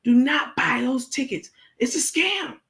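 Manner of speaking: high, over-enunciated frantic warning; crispy clear Crook. Retold or imitated. Crook warning fans about the tickets, high crisp diction. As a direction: spell out every word, high and urgent. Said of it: "she's speaking crispy clear".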